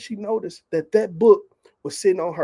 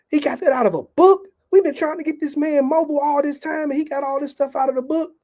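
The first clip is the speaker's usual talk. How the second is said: higher-pitched retold disbelief; mock-incredulous, pitched up; incredulous husband; 7 semitones higher, 4 dB louder than usual. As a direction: act out a husband who can't believe it.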